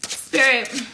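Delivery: normal talking voice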